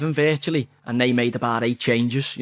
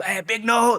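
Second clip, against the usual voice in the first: put-on kids calling out; 8 semitones higher than usual. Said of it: "kids, and going".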